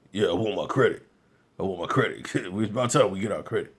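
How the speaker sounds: low, mock Charlamagne voice saying it; imitating Charlamagne. Goofy radio host mocking the credit claim, in a low voice. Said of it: "Be like, what's that ... goofy guy".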